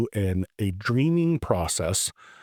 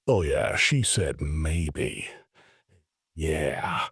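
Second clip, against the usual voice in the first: mock proud; 6 semitones lower, much slower than usual. Proudly reporting to Matt.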